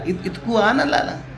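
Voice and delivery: soft, high affectionate call; lovingly calling. Retold or imitated. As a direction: coax him over sweetly. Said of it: "ऐसे बड़े प्यार से बुलाने लगी".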